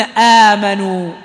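آمنوا said slowly with the first vowel held long; teacher models آمنوا; lengthened madd demo. Shown as a demonstration. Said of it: "فالذي يناسب الفتحة هو الألف".

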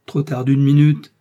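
loud outcry of regret; anguished exclamation. A narrator's reading. Lord de Winter tears his hair, loud anguished exclamation. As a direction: cry out at having just missed it. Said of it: "s'écriait-il".

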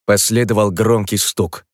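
ordinary talk, nothing marked

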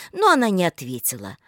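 ordinary delivery, nothing marked

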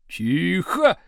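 one sharp bark for silence; barked order. A narrator's reading. Teacher to noisy class, barked order. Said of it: "гаркнул Слушкин".

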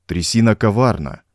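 plain reading voice

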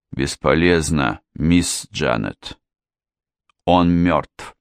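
low, halting, subdued; forced out. A narrator's reading. Mr Jones telling Janet it is useless, forcing it out. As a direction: squeeze out bad news in short heavy pieces. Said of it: "он выдавил".

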